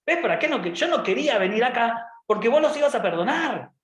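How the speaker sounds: high-pitched indignant complaint; whining Jonah. Acted. Jonah reproaching God for forgiving, indignant whine. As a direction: protest like a thwarted child. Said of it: "Jonás se queda como diciendo".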